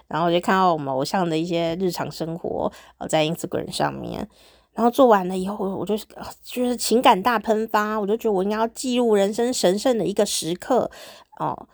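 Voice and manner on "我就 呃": normal talking voice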